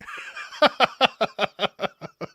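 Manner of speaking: long loud high laugh; hearty laugh. Real, not acted. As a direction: burst out laughing with glee. Laughing about telling off reporter.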